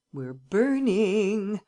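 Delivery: delighted. A narrator's reading. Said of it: "He revelled with delight".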